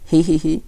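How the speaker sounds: high, loud acted "he-he-he"; mischievous giggle. A narrator's reading. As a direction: giggle gleefully at your trick. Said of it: "The boy laughed and laughed".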